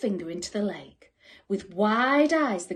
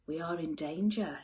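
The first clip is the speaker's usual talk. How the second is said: unicorn whispering a warning to the creatures; low hushed whisper of danger; 5 semitones lower, 7 dB quieter than usual. A narrator's reading. Unicorn warns the creatures in a whisper. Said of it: "he said".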